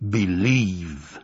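low, slow buffalo rumble; rumbling voice. A narrator's reading. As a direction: rumble it from beneath the earth. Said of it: "said the rumbling voice".

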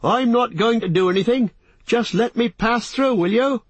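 high-pitched, exasperated shout; crying out. A narrator's reading. Hobbit pleading with the trees to let him pass, high cry. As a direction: shout a protest at the top of your range. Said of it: "he cried".

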